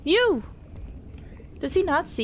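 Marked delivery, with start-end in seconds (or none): 0.0-0.4 startled. Real, not acted. Startled by the enemy.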